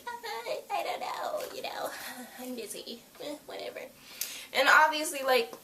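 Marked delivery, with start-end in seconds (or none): 0.1-3.8 soft, mumbled brush-off; half-whispered dismissive shrug; quietly muttering a brush-off; mock whisper. Friend's dismissive reply retold.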